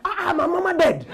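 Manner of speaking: put-on high, blunt Ugandan-accented reply; mock African accent. Comic voicing a Ugandan man who answers the neighbour. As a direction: deliver the bad news casually, accented.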